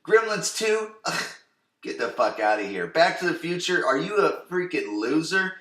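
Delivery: mock the nerdy movie picks with scorn; mock Dylan sneer. Dylan sneering at movie choices, high mocking scoff. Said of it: "where he's like".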